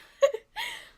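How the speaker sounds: squeaky laugh caught by a hiccup; high hiccupy laugh. Real, not acted. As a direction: giggle helplessly through hiccups. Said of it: "I think I'm getting the hiccups".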